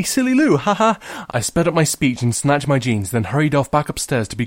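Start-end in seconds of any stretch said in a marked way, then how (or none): 0.0-1.0 high fake laugh; fake casual. Girl blames her sister, high fake laugh. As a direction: brush it off with a forced laugh.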